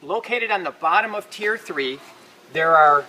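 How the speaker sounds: usual speaking voice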